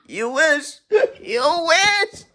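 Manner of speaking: high, drawn-out mocking jeer; playful high-pitched tease; gleeful taunt. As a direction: scoff it through amusement, rising on each go. Laughing scoff at imagined undercover-boss hopefuls.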